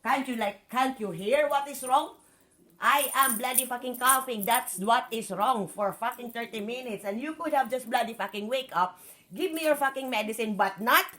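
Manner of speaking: furious tirade at the partner who kept the medicine; angry rant. Real, not acted. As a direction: tear into him for pestering her. Ranting at partner about medicine.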